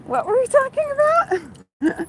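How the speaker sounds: high giddy question through laughter; giddy laughing. Real, not acted. Vlogger laughing off the coyotes, giddy and high. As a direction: shake it off with a laugh.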